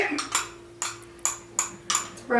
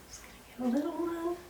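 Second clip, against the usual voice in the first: quiet; 4 semitones higher, 13 dB quieter, much faster than usual. Real, not acted. Rushed soft aside.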